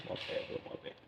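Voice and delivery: breathy whisper through a laugh; hushed laughing my bad; whispered apology. Real, not acted. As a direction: whisper it sheepishly, half laughing. Embarrassed aside after accent joke.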